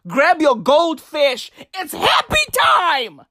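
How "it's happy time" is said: happy time shout. Acted. Hype catchphrase yelled.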